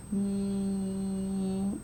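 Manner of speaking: "P" run into a long, level held vowel; "P" stretched slowly to show the next sound; drawn-out sounding. Shown as a demonstration. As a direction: hold the sound after "P" steadily. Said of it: "say the word again".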